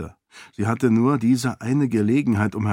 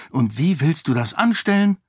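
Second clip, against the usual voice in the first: higher-pitched challenging question; Sarene, impatient; 6 semitones higher than usual. A narrator's reading. Lady challenges her Seon's authority, impatient. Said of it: "wollte Sarene ungeduldig wissen".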